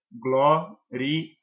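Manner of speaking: "gloria" split as gló-ri-a with pauses; syllable by syllable. Shown as a demonstration. Teacher showing the syllable division, "gloria" said piece by piece. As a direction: sound out "gloria" in three separate pieces. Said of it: "a divisão silábica dela".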